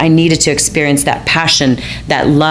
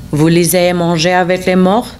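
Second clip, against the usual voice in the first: French; 2 semitones higher than usual. Retold or imitated. Romeo speaks French to her.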